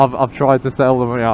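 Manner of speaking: ordinary talk, nothing marked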